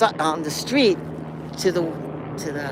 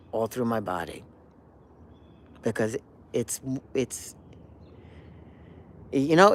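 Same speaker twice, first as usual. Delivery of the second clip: moved; 4 semitones lower, 4 dB quieter than usual. Real, not acted. Moved remembering the piece.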